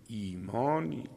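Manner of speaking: ordinary talk, nothing marked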